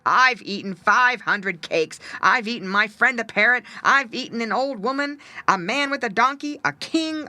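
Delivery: terrible voice. Acted. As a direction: puff up and threaten the crabs. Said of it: "cried the cat in a terrible voice".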